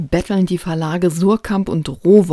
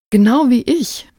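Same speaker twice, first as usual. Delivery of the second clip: higher, louder eager exclamation; eager call; 7 semitones higher, 6 dB louder than usual. Acted. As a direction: blurt it out keenly, raised and loud. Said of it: "rief Horzon".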